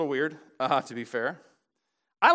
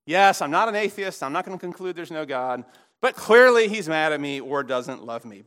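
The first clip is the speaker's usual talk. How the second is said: normal talking voice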